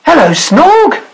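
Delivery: high, sing-out greeting; cheery sailor. A narrator's reading. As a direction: hail a friend as the sailor. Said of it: "said the sailor".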